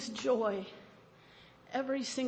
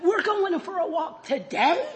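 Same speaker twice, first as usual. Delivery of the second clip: much louder, higher, slow overjoyed yelp; dog overjoyed; 4 semitones higher, 9 dB louder than usual. Pretend. Dog thrilled about his morning walk, loud and high. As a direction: act like you won the lottery. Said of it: "dog hit the freaking lottery".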